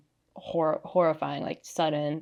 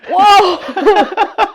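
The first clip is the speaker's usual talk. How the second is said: high, loud whoa through laughter; amazed whoa; 14 semitones higher, 16 dB louder than usual. Retold or imitated. Recounting the moment of revelation, amazed. Acts out stunned reaction in anecdote.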